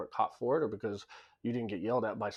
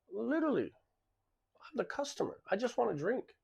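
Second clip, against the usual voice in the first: pitched way up, incredulous and fed up; exasperated disbelief; 12 semitones higher than usual. Real, not acted. Retelling being forced to show his wallet, high exasperated disbelief. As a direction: squeak it out like you can't believe this is happening. Indignant retelling of airport runaround.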